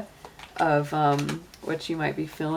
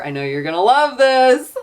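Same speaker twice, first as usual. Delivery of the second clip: playfully sarcastic, higher and louder; 8 semitones higher, 10 dB louder than usual. Real, not acted. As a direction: tease them with mock enthusiasm. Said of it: "I say sarcastically".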